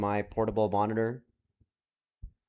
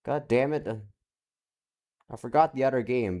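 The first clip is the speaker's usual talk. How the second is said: ordinary talk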